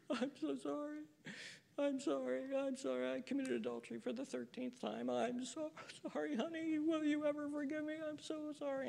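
high, soft whimpering fake sobs; mock crying husband. Preacher mocking an unfaithful husband's fake tearful apology. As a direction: imitate a husband blubbering false tears. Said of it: "there he is crying".

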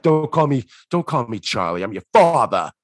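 low gruff dad imitation, loud on the last word; Alex Rocco. Charlie telling his daughter off, low imitated dad voice. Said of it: "a much closer Alex Rocco voice".

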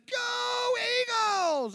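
loud, very high, drawn-out shout of "Go Eagles!"; shouted cheer. Retold or imitated. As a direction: yell "Go Eagles!" back across the field. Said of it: "I looked back and said".

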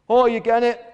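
loud, high hail; shouted greeting. A narrator's reading. Gull hailing gannet on the sea, shouted. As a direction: call out as if across water. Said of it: "he shouted".

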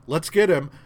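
rally the others to chase; eager call. Acted. Thor urging the gods after Loki, eager shout. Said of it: "Thor's like".

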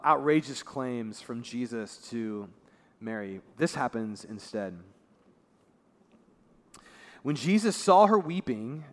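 ordinary delivery

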